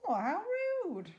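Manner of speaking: ordinary delivery, nothing marked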